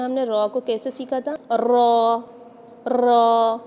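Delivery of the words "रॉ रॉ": रॉ with a long trilled r, said loudly; rolled ra. Shown as a demonstration. Teacher shows the trilled रॉ to beginners, rolling it loud. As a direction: trill the रॉ, repeating the r. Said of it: "इसे कहा जाता है तकरार".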